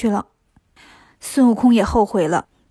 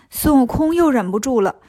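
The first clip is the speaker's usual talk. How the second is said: plain reading voice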